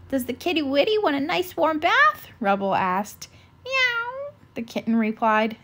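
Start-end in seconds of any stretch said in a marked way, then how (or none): 0.1-2.3 put-on higher, sing-song baby talk to a kitten. 3.6-4.3 very high little mew; kitten meow. Acted. The kitten replies.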